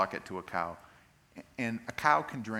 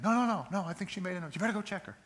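high, insistent mom voice; pitched-up worried parent; anxious mom; 7 semitones higher, faster than usual. Acted. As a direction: play a fretful mother overruling her partner. Acted worried mom at night.

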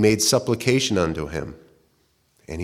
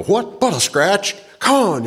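much higher, mocking, hammed-up knight shrugging off a wound; 11 semitones higher than usual.

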